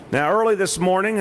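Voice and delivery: "early" pitched high and emphatic; stress on early. Real, not acted. Mayor recounting a predawn decision to press, stress on "early". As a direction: lean hard on "early", lifting the pitch. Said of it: "I emphasize the word early".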